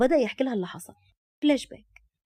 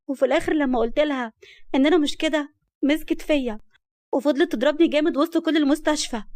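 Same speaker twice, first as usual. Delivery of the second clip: higher, louder plaintive complaint; playing the victim; 5 semitones higher, 5 dB louder than usual. A narrator's reading. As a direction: plead your case as the poor wronged one. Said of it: "واتكلمت رحمة بمسكنة".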